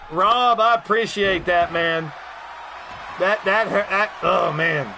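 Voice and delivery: ordinary delivery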